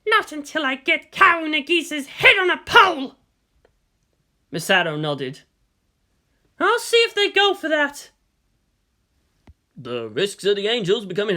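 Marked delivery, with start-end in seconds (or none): 0.1-3.1 pained snarl. A narrator's reading. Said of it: "gave out a pained snarl". 6.6-8.1 higher, grown woman voice; measured commander voice. A narrator's reading. Misato voiced in story.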